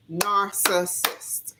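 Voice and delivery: Narcissist said slowly, syllable by syllable; drawn-out word. Shown as a demonstration. Vlogger mocking a long word, drawn out slowly. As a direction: stretch out Narcissist to show how long it is. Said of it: "it's so long and it spells so long".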